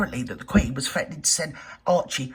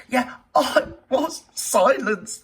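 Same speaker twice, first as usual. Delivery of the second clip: mock lament; 5 semitones higher, 5 dB louder, much slower than usual. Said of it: "mocking the Duchess again".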